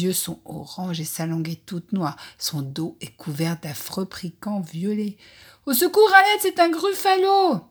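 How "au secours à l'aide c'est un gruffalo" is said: high piercing cry of terror; scream for help. Acted. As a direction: shriek for help, panicked. Mouse cries for help at monster.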